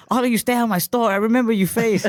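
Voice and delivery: put-on high, loud angry accented rant. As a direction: storm off shouting in the accent. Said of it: "the dude just gets pissed".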